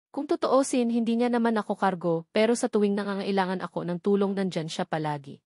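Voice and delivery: ordinary delivery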